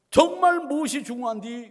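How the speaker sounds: put-on loud, high Chungcheong-accented exclamation; Chungcheong dialect. Pastor applies film line to congregation, emphatic Chungcheong accent. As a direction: press the point in Chungcheong dialect. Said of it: "충청도 사투리죠".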